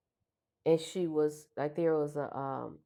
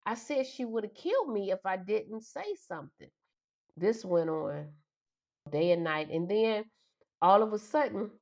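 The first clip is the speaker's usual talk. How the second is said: usual speaking voice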